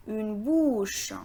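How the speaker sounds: ordinary talk, nothing marked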